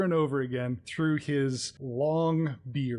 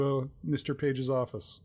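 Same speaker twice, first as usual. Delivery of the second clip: usual speaking voice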